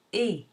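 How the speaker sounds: "E" said as French closed é, R silent; a tight, pure "E" like é. Shown as a demonstration. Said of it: "is pronounced".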